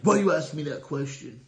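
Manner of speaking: a half-acted snide grumble, voice shifted from his own; snide retort. To Sean in the car, a mock snide brush-off. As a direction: brush off the question like a man with a lot on his mind. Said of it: "you know, a snide way".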